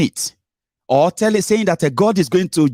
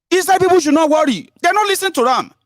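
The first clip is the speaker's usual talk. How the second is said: mocking high voice; 13 semitones higher than usual. Mocking governor's assurance.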